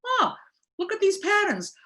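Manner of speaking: a sponsee spotting patterns, surprised; surprised discovery. Retold or imitated. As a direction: act out the sudden realisation. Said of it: "You're like".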